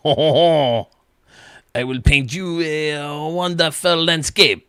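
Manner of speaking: hammed-up French accent, savoring each word; bad French accent. Joking that the lumberjack logo looks like a French painter, in a slow mock French accent. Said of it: "I don't do a good French accent".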